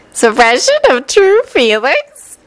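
pondering with a smile. A narrator's reading. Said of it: "Renge pondered aloud with a smile".

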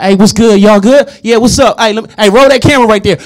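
put-on loud, high, swaggering American accent. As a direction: burst in as a cocky American. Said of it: "I was in American accent".

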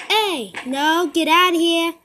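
loud get out; shouting at enemy. Real, not acted. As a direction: yell them off the spot. Shooing an enemy player away.

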